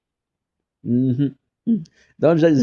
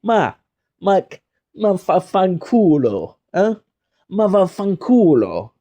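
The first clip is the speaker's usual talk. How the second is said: higher, slow Italian-American "ma va fanculo"; Italian curse impression; 7 semitones higher, 3 dB louder than usual. Telling the guest how to answer strangers, Italian-American accent. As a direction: throw it at them like an offended Italian uncle. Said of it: "you do like that to them".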